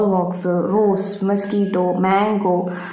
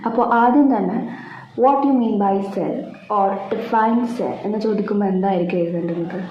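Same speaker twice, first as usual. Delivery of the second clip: ordinary talk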